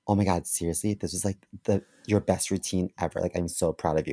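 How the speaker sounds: mildly mocked gushing; gushing girl. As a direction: imitate a fawning fellow comic. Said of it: "she's like".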